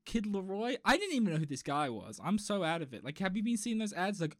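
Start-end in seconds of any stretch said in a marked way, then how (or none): none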